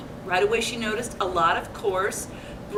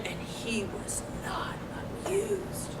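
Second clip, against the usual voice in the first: voiceless whisper, ending high; 7 semitones higher, 13 dB quieter than usual. A narrator's reading. Reading to children, whispering Papa Bear is not amused. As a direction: hush it with mock gravity. Dry suspense aside.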